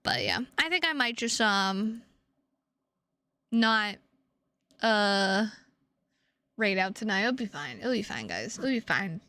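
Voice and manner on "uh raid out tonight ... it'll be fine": ordinary talk, nothing marked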